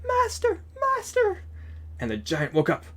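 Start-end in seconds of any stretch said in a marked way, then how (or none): 0.1-1.4 very high piping cry for its master; a thin magic harp calling out, way up high. A narrator's reading. Magic harp calling its giant master, very high cry. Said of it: "the harp cried".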